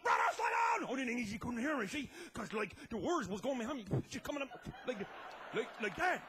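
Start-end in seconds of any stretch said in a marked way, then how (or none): 0.0-0.9 yelling up over the wind; screamed. Retold or imitated. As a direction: shout to a brother ahead of you. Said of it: "I screamed up".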